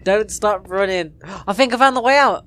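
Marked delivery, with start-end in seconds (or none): 1.5-2.4 excited. Real, not acted. Finds exit while being chased.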